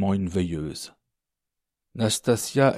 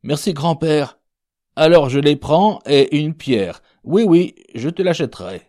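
put-on higher, louder young driver's reply; eager thanks; 7 semitones higher, 8 dB louder than usual. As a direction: answer grandfather brightly and readily. Serge eagerly answers the sick man. (remerciement empressé, plus haut et fort)

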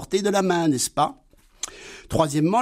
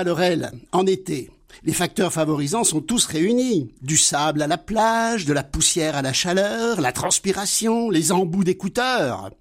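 normal talking voice

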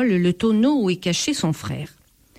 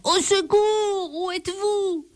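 terrified cry for help; 11 semitones higher than usual. A narrator's reading. The frightened thief calls for his brothers in terror. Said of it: "fou de peur".

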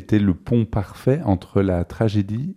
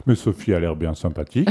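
normal talking voice

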